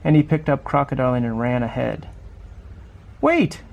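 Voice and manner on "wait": sharp high call to stop him; shouted. A narrator's reading. Mom shouting after her son to wait. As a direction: call out to stop the running child. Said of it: "shouted Mom very loud".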